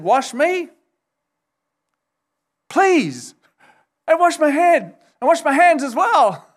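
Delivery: overeager, asking for the whole bath; eager Peter. Retold or imitated. Peter swinging to the opposite extreme, begging Jesus eagerly. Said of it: "Peter responded to that and said".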